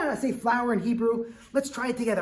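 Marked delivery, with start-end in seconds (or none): none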